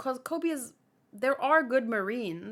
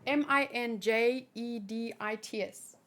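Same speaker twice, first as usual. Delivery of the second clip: ordinary talk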